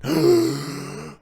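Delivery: high theatrical mock gasp. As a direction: gasp like a scandalized lady. Mock shock at news.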